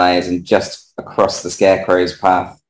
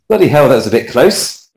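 higher, mildly alarmed outburst; startled exclamation; 6 semitones higher, 4 dB louder than usual. Acted. Ismark reacts to horses nearly trampling him, startled exclamation. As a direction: blurt it out after a near miss.

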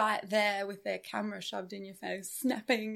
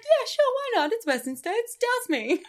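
laughing; 9 semitones higher, 10 dB louder than usual. Retold or imitated. Laughing retelling accepting water.

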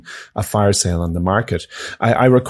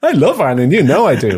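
mock protest; 6 semitones higher, 4 dB louder than usual. Real, not acted. Protesting his love, teased.

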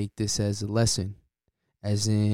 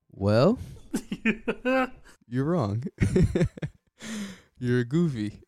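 teasing; 8 semitones higher than usual. Acted. Teasing the listener.

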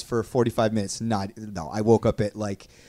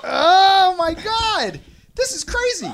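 excited laughing; 6 dB louder, slower than usual. Real, not acted. Watching the match.

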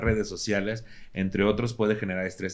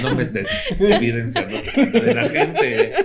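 high, loud protest through laughter; embarrassed, laughing complaint; 11 semitones higher, 7 dB louder than usual. Real, not acted. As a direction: plead not to be exposed, laughing. Embarrassed, teased amid laughter.

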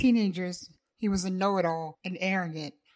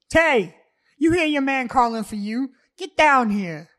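loud, higher shout up the stairs; yelling upstairs; 6 semitones higher, 9 dB louder than usual. Acted. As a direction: bellow for her to come down. Davon yells upstairs.

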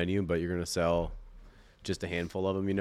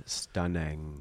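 imitating a server; 4 semitones lower than usual. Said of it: "It's just the way she, like, cuts her off".

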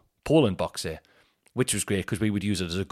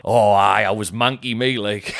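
Geordie mate; 7 dB louder than usual. Retold or imitated. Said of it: "he just said something like".

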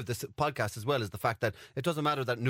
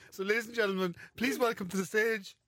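announcer voice; 6 semitones higher than usual. Pretend. Mock stage introduction.

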